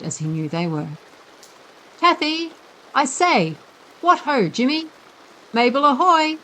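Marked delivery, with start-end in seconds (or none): 2.0-6.4 high, bright calling out to friends; forced jolly hail, hiding his fear; loud cheerful call. A narrator's reading. Boy calling to frightened friends through the house, falsely cheerful. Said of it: "he cried in a loud, cheerful voice".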